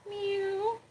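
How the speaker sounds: high soft meow; mock meow. Real, not acted. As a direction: meow gently at the kitten. Meowing at the cat.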